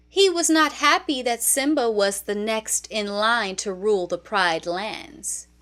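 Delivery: ordinary delivery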